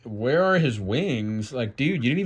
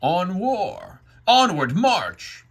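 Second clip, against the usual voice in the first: higher, drawn-out battle call; marching order; 7 semitones higher, 4 dB louder, slower than usual. Acted. Satan orders dark angels to march, commanding call. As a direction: call the troops forward, raised voice.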